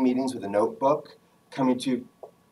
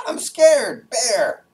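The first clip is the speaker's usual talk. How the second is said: high, loud cry of alarm; hammed-up panic at a sudden sight; mock scared; 11 semitones higher, 7 dB louder, much slower than usual. Someone surprised by a bear, mock-frightened high yell. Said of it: "they're not actually scared".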